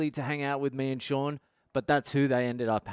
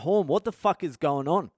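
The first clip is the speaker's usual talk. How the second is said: higher, urgent retold question; mildly raised, alarmed voice; alarmed demand; 4 semitones higher, 4 dB louder than usual. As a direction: re-enact demanding answers from a mate. Alarmed, demands answer from mate.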